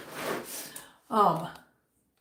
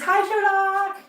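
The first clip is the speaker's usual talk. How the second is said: high, louder sing-song hello; voicing a greeting; 7 semitones higher, 5 dB louder, slower than usual. Reading a viewer's hello to her cat, high sing-song. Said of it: "Andre says".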